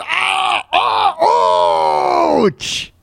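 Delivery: hammed-up cries of pain. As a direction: play a stabbed man crying out. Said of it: "I'd be going".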